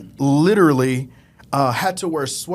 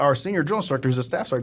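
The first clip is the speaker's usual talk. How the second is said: ordinary talk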